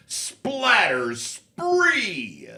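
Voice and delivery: high, slow booming announcer call. Pretend. Halo voice actor calling out a medal, high announcer voice. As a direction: shout the medal like a game announcer.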